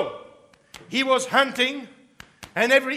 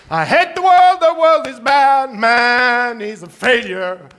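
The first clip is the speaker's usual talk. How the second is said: loud, higher grumbling lament; loud moaning rant; 4 semitones higher, 5 dB louder than usual. Acted. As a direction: whine at the world and the woman. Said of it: "off he went moaning".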